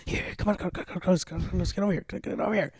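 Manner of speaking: quiet urgent hurry-up; urgent hushed dad. Retold or imitated. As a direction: hustle the kid along under your breath. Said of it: "be like".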